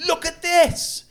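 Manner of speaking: high squealed look at this; shrieking it out delighted; squealed high and loud; excited shout. Retold or imitated. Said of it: "she was like".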